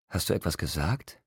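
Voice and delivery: a touch of surprise, asked softly; surprised, odd. A narrator's reading. Edward asks Bella if she spoke, mildly surprised. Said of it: "Es klang überrascht, merkwürdig".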